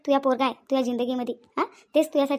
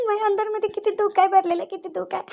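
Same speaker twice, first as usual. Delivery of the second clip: tearful; 3 semitones higher than usual. Acted. Wife confessing her grief to Rohini, crying. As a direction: let the sob break through the words.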